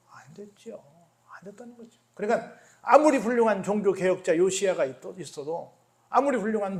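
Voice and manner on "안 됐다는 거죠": breathy slow whisper; slow, hushed whisper. Acted. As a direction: drop to a hushed whisper for effect. Preacher drops to a whisper.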